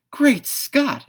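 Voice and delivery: very high, loud shocked cry; Great Scott flung out in shock; exclaiming. A narrator's reading. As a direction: cry out in astonishment. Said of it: "exclaimed Jack".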